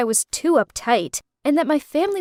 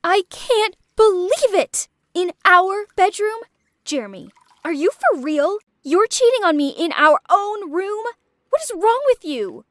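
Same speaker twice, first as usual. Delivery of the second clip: furious; 6 semitones higher than usual. Acted. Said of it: "She was super angry".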